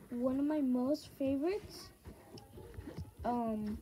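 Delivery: talking while chewing gum; words round a wad of gum. Real, not acted. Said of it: "sorry, I'm chewing gum".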